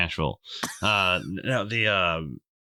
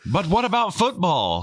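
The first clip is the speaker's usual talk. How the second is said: mock shout; 10 semitones higher than usual. Mocking football-obsessed fans.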